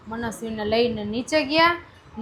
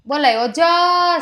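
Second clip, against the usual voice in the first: put-on slow, drawn-out call of ઓજસ for the child to copy; slow prompt; 4 semitones higher, 3 dB louder, slower than usual. Shown as a demonstration. Mother modelling the name ઓજસ slowly for her daughter. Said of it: "મોટેથી બોલાવે એટલે આવશે".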